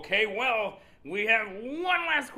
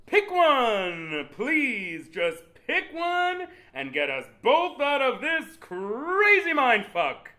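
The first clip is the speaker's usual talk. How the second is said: big swooping desperate host plea; beg, stretching the key words; hammed-up exasperation; exasperated pleading; 9 semitones higher, 8 dB louder, slower than usual. Host begs contestant to decide.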